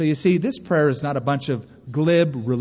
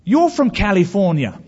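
higher, louder put-on voice of an English counterman; mock English shop-worker, raised and emphatic; English shop clerk; 5 semitones higher, 5 dB louder than usual. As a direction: imitate a London clerk calling out the foreigner. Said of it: "the guy behind the counter said".

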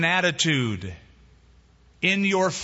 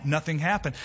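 normal talking voice

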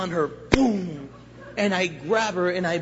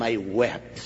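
ordinary talk, nothing marked

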